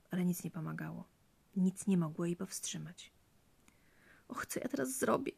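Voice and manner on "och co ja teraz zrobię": soft, high-pitched distressed wail; tearful lament. A narrator's reading. Frightened wife at a loss over the bleeding key, tearful lament. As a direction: lament softly, a touch tearful. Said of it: "płakała".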